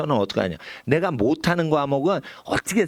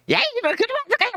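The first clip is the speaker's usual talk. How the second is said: scolding yell; much faster than usual. Acted. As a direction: yell at the kid to stop. Parent scolding again, acted. (아이를 꾸짖는 높고 큰 외침)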